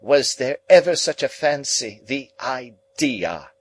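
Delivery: high, loud, indignant exclamation; high-pitched scandalised outburst. A narrator's reading. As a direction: cry it out affronted, drawing out the last words. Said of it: "with indignation".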